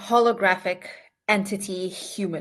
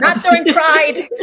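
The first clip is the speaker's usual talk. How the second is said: laughing quip; 9 semitones higher, 11 dB louder than usual. Real, not acted.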